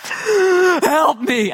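high, loud wailing cry to Jesus; hammed-up child's panicked plea; dramatic cry for help. Hurt boy cries out to Jesus, high loud wail. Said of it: "all of a sudden crying out and going".

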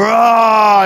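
imitated roar of a dunk; little boy's yell. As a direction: roar it out like a boy dunking. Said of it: "and he'd go".

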